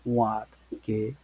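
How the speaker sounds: ordinary talk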